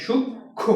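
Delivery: সূক্ষ্ম split slowly as "shuk... kho"; no vowel after k. Shown as a demonstration. Teacher explaining the hasanta under k, no vowel after k. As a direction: close the first syllable of সূক্ষ্ম on k, then kho. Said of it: "ওকার বা আকার উচ্চারণের সময় আমরা করি নাই".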